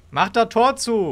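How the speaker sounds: hoch und gedehnt gerufener Befehl; Hinterherrufen. Pretend. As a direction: ruf es langsam jemandem hinterher. Ruft Weggehendem hinterher. (hoch hinterhergerufen)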